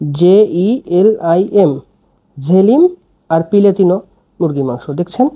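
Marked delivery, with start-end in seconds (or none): 0.1-1.8 slow spelling. Shown as a demonstration. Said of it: "এই যে এটা হচ্ছে".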